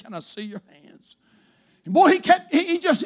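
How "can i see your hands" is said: a frail woman asking quietly; sick lady. Retold or imitated. Lightly voiced sick lady asking the priest, soft.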